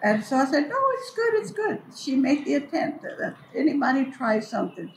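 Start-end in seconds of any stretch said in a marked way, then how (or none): 0.7-1.8 high mock reassurance. Retold polite reassurance.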